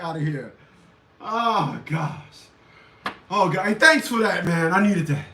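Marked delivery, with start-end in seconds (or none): none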